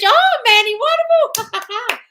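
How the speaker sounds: very high, loud mock rapture; over-the-top delight. Coach celebrating affirmed riches, hammed-up delight. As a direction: squeal it out, hammed-up and drawn out.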